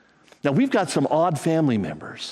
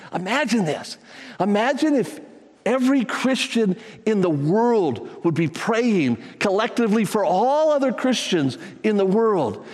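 ordinary delivery

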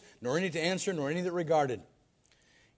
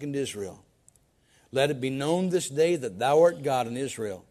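simple prayer; 4 semitones lower than usual. Acted. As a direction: pray humbly, without show. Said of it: "watch this simple prayer".

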